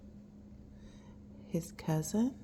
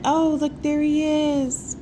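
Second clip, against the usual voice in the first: excited; 8 semitones higher, 7 dB louder, slower than usual. Acted. Excited slow reading.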